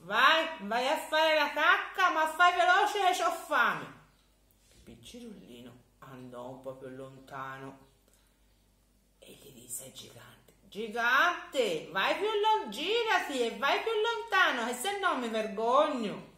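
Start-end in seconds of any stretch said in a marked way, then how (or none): 0.1-3.9 high, loud, hurried giant; a hungry giant rushing the boy; impatient giant. Acted. 10.7-16.3 put-on high, loud child's voice; a boy tricking the giant into walking off; boy pretending to be embarrassed; shy little boy. Boy sends giant away.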